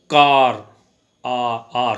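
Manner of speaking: कार with a long stressed आर; teacher demonstrating a vowel; ar sound. Shown as a demonstration. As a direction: stretch the आर in कार. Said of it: "कार की sound इससे".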